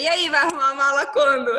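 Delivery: provocando alto, triunfante; provocação animada. Retold or imitated. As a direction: cutuca o marido, vitoriosa e mais alta. Provoca o marido após passar. (esposa provoca o marido depois de passar, triunfante)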